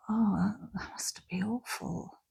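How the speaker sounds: whispered, slow "Oh, that must be awful"; sympathetic. Shown as a demonstration. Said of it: "let's try sympathy".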